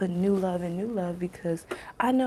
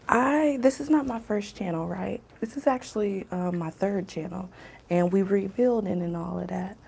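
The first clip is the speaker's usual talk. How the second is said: normal talking voice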